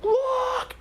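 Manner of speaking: single high screamed word; re-enacted scream. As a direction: shriek it to make them look. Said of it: "I mean, I'm screaming".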